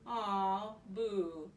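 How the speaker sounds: ordinary delivery, nothing marked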